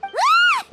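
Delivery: high, loud startled shriek; shocked yelp. Acted. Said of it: "make a sound like that".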